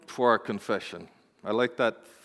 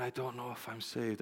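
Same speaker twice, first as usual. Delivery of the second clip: very hushed, understated sorrow; retold tearful confession; 2 semitones lower, 14 dB quieter than usual. Crying saint doubting her salvation, retold in a hushed voice. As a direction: drop far down, gently echoing her distress. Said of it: "she's crying at the front".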